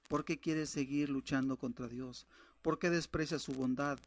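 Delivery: ordinary talk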